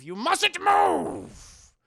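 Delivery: slow, high, loud warning; imitating a villain's sudden outburst; stern command. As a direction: bellow it, stretching out "move". Howard forbids movement, then softens.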